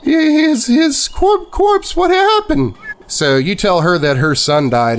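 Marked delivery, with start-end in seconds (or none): 0.0-2.8 put-on horrified old mother. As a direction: choke on the word as a horrified mother.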